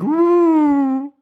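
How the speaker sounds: loud high plane whoosh; airplane noise. Pretend. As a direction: zoom the spoon in like a plane. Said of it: "Dann kommt nochmal das Flugzeug". (lautes Flugzeuggeräusch, hoch)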